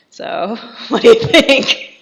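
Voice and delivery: high, loud, amused mock question; laughing imitation. Marquis showing off his walls, voiced through laughter. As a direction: quote him while cracking up.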